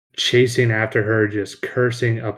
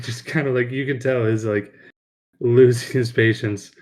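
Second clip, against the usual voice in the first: ordinary talk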